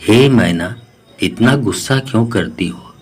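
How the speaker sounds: usual speaking voice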